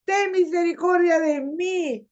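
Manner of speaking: high, drawn-out plea shouted from the roadside; acted desperate shout; crying out. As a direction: call out high, begging for mercy. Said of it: "él comenzó a gritar y a decir".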